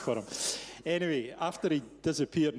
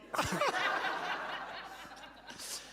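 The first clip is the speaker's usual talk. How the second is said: high, free burst of laughter; big laugh. Real, not acted. Speaker laughing at the punchline of his own story.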